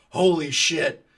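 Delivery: startled exclamation. Retold or imitated. Friend startled by engine.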